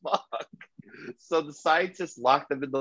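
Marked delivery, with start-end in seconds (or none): 0.0-0.5 shocked disbelief. Retold or imitated. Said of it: "And they're like".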